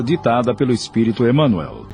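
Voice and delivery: ordinary delivery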